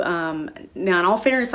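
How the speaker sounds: ordinary talk, nothing marked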